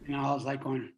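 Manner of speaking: usual speaking voice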